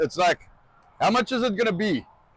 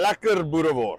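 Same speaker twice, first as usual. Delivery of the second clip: Lekker boerewors in a relaxed South African accent. Shown as a demonstration. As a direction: savour it like a proud South African. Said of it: "say it in your lovely accent".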